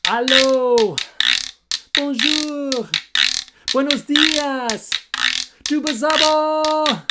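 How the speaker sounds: high, loud sing-song calling; call for help. Shown as a demonstration. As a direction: call out each word for the kids to repeat. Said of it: "Here we go, ready, repeat".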